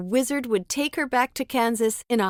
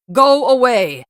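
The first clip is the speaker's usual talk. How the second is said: much louder booming wizard shout; angry shout; 9 dB louder than usual. Acted. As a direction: boom it angrily at them. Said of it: "the wizard shouted angrily".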